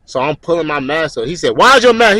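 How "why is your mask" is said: raised, higher-pitched demand; acted instructor's loud reprimand; scolding instructor. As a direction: sharply call out a recruit. Acted instructor scolding over mask.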